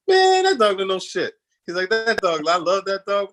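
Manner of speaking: high, loud, slow scoff at the dog; dismissive son. Retold or imitated. Steven's son laughing off the dog with a high scoff. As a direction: brush it off like it's nonsense.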